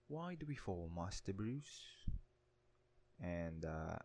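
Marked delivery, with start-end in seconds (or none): 0.1-2.1 softer English butler accent, high start, slow Master Bruce; English accent again, slow and gentle; Alfred's English accent. Shown as a demonstration. Retaking Alfred's line in an English accent. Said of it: "That's my best attempt at an English accent".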